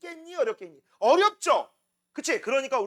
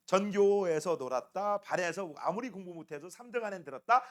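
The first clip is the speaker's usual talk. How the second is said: lower, mock parental boast; parental bragging; 6 semitones lower, 4 dB quieter than usual. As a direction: brag to your child in a low, measured voice. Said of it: "이렇게 뻥을 치시겠지만". (낮은 톤으로 허풍 떠는 부모 흉내)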